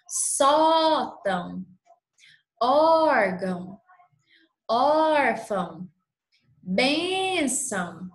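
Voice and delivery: "Sótão, órgão, órfão, bênção" with first syllable long and stretched; calling out the word. Shown as a demonstration. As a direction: call out each word slowly, dragging the SÓ, ÓR, ÓR, BÊN. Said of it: "de chamar a palavra". (chamando "Sótão, órgão, órfão, bênção", primeira sílaba bem alongada)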